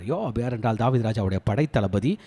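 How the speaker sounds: ordinary talk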